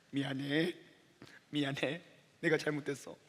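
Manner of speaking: low, hushed re-enacted apology; remorseful apology. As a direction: apologise softly and humbly to your wife. Re-enacts kneeling apology to wife.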